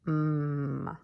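hummed "Mm", held loud; m sound. Shown as a demonstration. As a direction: hum "Mm" with lips closed. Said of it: "An M says".

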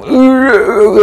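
high strangled groans while being throttled; choked groan. Retold or imitated. Uncle being strangled, high choked groaning. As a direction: groan like someone choking. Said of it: "ư vậy nè".

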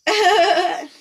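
high, loud delighted hello; light up when a friend arrives; excited greeting. Real, not acted. Greeting a friend who joined the reading, high and thrilled. Spots a friend joining the stream.

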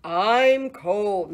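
loud, mooing complaint of cold; mooing cow. Acted. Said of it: "mooed the cow".

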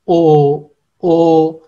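teacher showing the dotted ओ; throaty Turkish vowel. Shown as a demonstration. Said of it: "गले के निचले हिस्से से हमने इसको अदा करना होता है".

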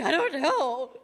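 high, loud comic wail; hammed-up frantic pleading; mock freak-out. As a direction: panic theatrically, close to laughing. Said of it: "I would just freak out".

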